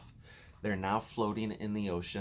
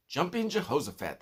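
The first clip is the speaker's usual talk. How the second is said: louder, higher cry of surprise; amazed cry; 7 semitones higher, 5 dB louder than usual. A narrator's reading. The bugs cry out at James' idea, amazed. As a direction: exclaim it in delight. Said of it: "they cried".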